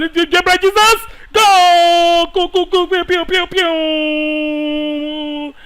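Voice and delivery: high, loud shouting of go and pew; excited shout. Real, not acted. Gamer cheering his striker toward goal, shouting.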